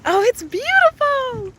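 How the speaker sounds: high, slow, gushing delight; delighted awe. Real, not acted. Streamer seeing a beautiful ocean park, delighted. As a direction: stretch the words high with delight.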